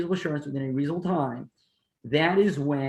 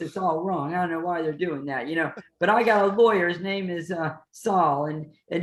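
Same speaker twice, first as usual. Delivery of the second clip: higher-pitched, rambling shady operator; shady businessman; 3 semitones higher than usual. Acted. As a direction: play a shady dealer talking his way out of trouble. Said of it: "I'm a little shady".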